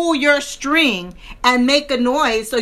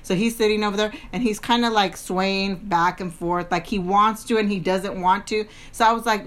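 ordinary delivery

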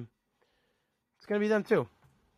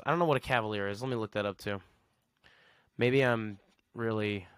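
ordinary delivery

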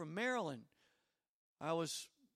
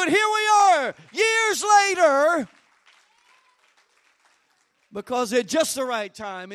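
loud, raised, full-force preaching; 5 semitones higher, 12 dB louder than usual. Real, not acted. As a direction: drive it up to a triumphant shout.

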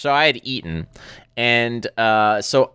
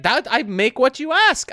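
high-pitched mock-innocent grandma; imitated grandma; 11 semitones higher than usual. Grandson imitating grandma defending the meal she made, mock-innocent. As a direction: imitate her protesting in a high voice.

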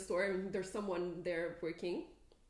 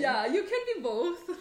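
laughing; 5 semitones higher, 9 dB louder than usual. Real, not acted. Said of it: "repeating her retort".

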